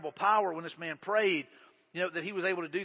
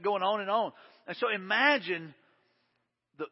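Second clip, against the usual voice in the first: normal talking voice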